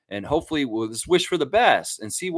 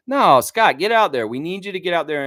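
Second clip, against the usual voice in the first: loud, higher mock call to a player; urgent plea; 5 semitones higher, 6 dB louder than usual. Fan urging Scottie to play tonight, urgent plea. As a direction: call out to the player, pushing him onto court.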